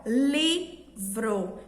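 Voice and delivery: "livro" drawn out very slowly as LI... vro; stress on LI. Shown as a demonstration. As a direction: lean on the first syllable of "livro", split in two. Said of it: "entonação foi mais para a sílaba li". ("livro" bem devagar, com ênfase no LI)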